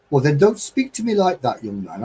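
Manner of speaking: posh lady's telling-off, starting high, dropping at the end; Margaret. Retold or imitated. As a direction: imitate a well-spoken convent lady scolding a youngster. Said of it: "Margaret was very posh and convent".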